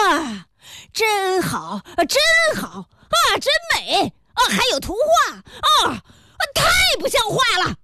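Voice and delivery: high-pitched outraged mock admiration; scandalized sarcasm. A narrator's reading. Pharmacist leafing through the scandalous book, sarcastically outraged.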